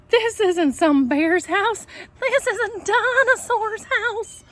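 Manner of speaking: put-on high, loud alarmed girl voice ending in a laugh; startled realization. A narrator's reading. Goldilocks to herself, high and alarmed, then laughing. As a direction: squeal it as the truth dawns.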